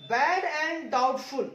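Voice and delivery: very slow, drawn-out words; slow dictation. Shown as a demonstration. Teacher dictating a term to students, very slow. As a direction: stretch each word for the students. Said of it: "teacher repeats term slowly".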